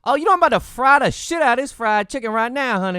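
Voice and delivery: high, loud mock Southern accent; hammed-up put-on accent. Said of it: "she just turns into Aunt Jemima".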